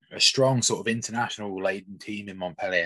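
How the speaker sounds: ordinary delivery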